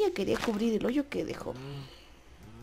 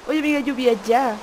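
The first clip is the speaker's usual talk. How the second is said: much louder call to the rain; 3 semitones higher, 9 dB louder than usual. Real, not acted. Gamer calling the rain to stop, loud. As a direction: shout up at the sky.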